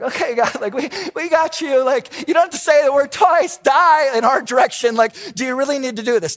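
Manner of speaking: a fed-up "we got you" to God; exasperated pushback. Pretend. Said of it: "It's like".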